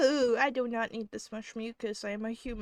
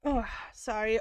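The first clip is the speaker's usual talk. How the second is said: ordinary delivery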